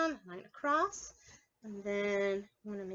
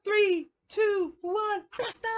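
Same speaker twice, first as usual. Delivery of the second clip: excited countdown; 9 semitones higher, 6 dB louder, slower than usual. Acted. Countdown to blastoff.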